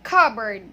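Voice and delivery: "cupboard" as CUB-erd, loud and falling; "cupboard" with two short schwa vowels; correct pronunciation. Shown as a demonstration. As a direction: silent p in cupboard. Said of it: "so we read it as".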